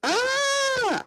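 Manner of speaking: piercing scream, very high. Acted. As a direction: let out a sudden terrified scream. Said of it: "just screamed".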